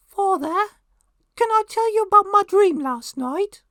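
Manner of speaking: put-on very high, loud little English boy voice. Son asking father about a dream, mock English boy voice. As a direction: pipe up like a boy from Mary Poppins. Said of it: "when I do a little boy's voice".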